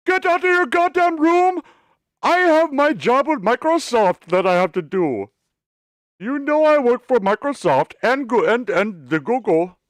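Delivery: put-on very high, loud accented ranting; hammed-up accent parody; mock Indian accent rant. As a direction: rant like the clerk in a bad accent. Parodying clerk with Microsoft job.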